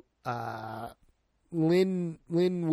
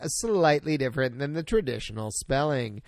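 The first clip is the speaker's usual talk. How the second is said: usual speaking voice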